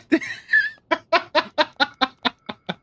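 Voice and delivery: long, high-pitched cackling giggle. Real, not acted. Streamer tickled by own comeback, high giggling. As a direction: laugh it out in a high staccato run.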